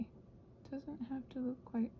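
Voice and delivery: ordinary talk, nothing marked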